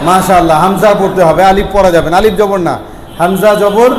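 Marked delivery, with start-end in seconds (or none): none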